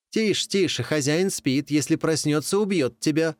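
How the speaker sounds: high, urgent warning; scary warning. Acted. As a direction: hush her and threaten. Animals scare off wolverine.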